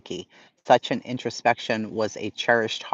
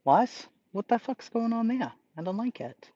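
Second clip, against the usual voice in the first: imitating dream confusion, pitched up; mock bewildered voice of her half-asleep self; confused; 10 semitones higher, 5 dB quieter than usual. Streamer retelling a sidebar invading her dream, mock confusion. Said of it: "and I'm like".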